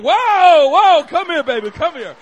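put-on loud, high cry to stop a toddler; alarmed shout. Father calling his dancing toddler off the pole, alarmed shout. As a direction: urgently call the child back, high and very loud.

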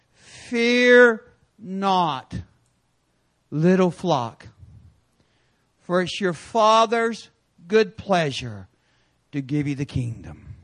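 slow verse reading with long pauses. Real, not acted. Preacher reads a verse for listeners to write down, slowly. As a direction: read it out slowly, phrase by phrase. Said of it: "I'll read it slow enough".

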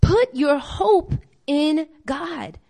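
very slow, each word weighted; slow exhortation. Shown as a demonstration. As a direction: drive the line home slowly. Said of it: "repeated exhortation".

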